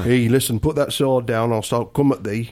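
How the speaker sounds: Game of Thrones northerner; broad northern English, like a sword-wielding warrior; northern English accent. Acted. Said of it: "examples of the north of England".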